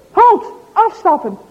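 loud sharp command to stop; calling out halt. Acted. Policeman halting a cyclist, loud command. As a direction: bark it out like a policeman. Policeman Klumpers halts father.

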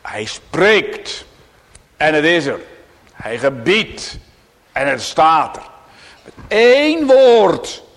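loud, slow proclamation; fervent pulpit proclamation; preaching at full force. Acted. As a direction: hammer each phrase home.

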